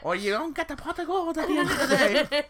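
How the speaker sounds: very high, loud leprechaun scolding; hammed-up Irish leprechaun with a laugh. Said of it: "It's a fucking leprechaun".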